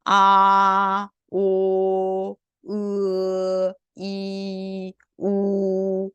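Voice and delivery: long, evenly held vowels on one pitch; connected vowel recitation. Shown as a demonstration. Teacher reading six vowels with students, slow and sustained. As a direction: sustain each vowel slowly and levelly. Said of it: "제가 이어서 같이 읽어볼게요".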